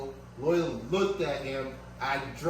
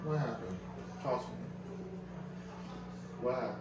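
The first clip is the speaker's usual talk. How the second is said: soothing the dog; 6 semitones higher, 5 dB quieter than usual. Real, not acted. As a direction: comfort him like a scared pet. Talking to hurt dog tenderly.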